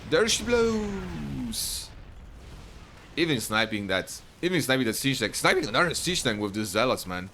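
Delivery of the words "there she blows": high, long-held lookout's cry; drawn-out call. Acted. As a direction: call it out high, stretching the last word. Commentator calls out dramatic moment.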